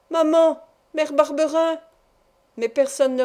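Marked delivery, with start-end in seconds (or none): 0.1-1.8 high, loud desperate call. A narrator's reading. Boy calling for his mother as he's led away, loud. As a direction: call for her across the fields. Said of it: "Je me mis à appeler".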